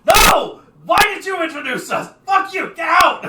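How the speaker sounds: high, loud screaming rage; furious shout. Acted. Angry wall faces screaming at the giant to leave. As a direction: scream him out of the room. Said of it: "they go".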